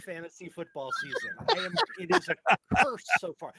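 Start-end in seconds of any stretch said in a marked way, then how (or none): 1.5-3.5 mock despair. Real, not acted. Lamenting fantasy season.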